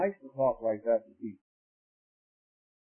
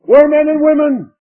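high, loud, drawn-out pulpit cry; full-force preaching; 10 semitones higher, 16 dB louder than usual. Real, not acted. Preacher picturing the lost crying out to God, full-force preaching. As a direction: cry out the horror to the congregation.

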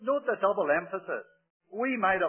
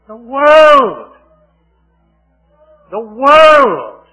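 loud, high shout on world; preacher's ringing shout; 5 semitones higher, 18 dB louder, much slower than usual. Real, not acted. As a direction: cry out against the world. Preacher crying out repeated phrase.

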